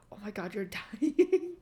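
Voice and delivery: lightly hammed-up parental panic, breaking into a laugh; mock panicked parents. Mimicking her parents freaking out that she is dying, half laughing. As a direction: play the parents overreacting, laughing at them.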